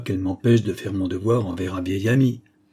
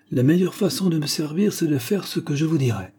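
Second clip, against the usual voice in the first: higher-pitched, peremptory patient; lightly acted imperious order; authoritarian; 6 semitones higher than usual. A narrator's reading. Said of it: "Il n'avait jamais été plus autoritaire". (ton autoritaire de malade, plus aigu)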